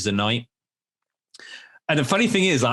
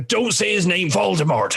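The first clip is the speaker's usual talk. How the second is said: high-pitched, acted storybook warning; Hagrid character voice; 7 semitones higher than usual. Dad acting out the book at bedtime, high warning voice. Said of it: "I'm doing all the voices".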